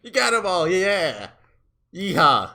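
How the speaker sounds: loud, high victorious yeehaw; cowboy whoop. Acted. Billy cheering after gunning down the enemies, loud whoop. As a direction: holler it like a cowboy who just won.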